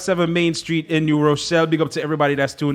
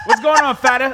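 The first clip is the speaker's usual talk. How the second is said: a playful, higher mock American greeting; imitating an American accent; 8 semitones higher than usual. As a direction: tease the Yankee way of saying "Father". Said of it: "That's what the Yankee them say".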